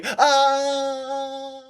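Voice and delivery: high scared scream. Acted. Ed fleeing the water, high scared scream. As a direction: shriek and flee. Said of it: "ran away".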